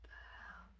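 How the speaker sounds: barely audible whispered disbelief; unnerved whisper. Retold or imitated. Woken in the dark by noise, spooked whisper.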